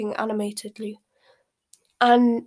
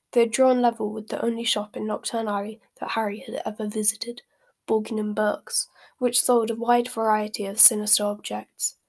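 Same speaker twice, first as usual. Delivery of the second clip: ordinary delivery, nothing marked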